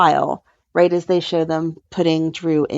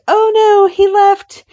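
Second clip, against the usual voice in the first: much higher, louder, hammed-up alarm; mock dismay; 13 semitones higher, 6 dB louder, slower than usual. Lawyer reacts as the judge walks out, hammed-up high dismay. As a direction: squeal it in fake panic. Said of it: "she's like".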